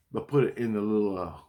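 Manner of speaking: normal talking voice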